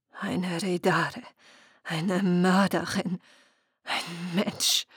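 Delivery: slow, quiet, aching words; pained. Acted. Muriel names herself murderer to the selkies, slow and pained. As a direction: let the pain drip from each syllable. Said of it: "den tief empfundenen Schmerz in ihren Worten".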